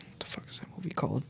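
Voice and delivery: whispered aside to himself; hushed, breathy search for the title. Real, not acted. Friend muttering to himself while trying to recall a movie, whispered.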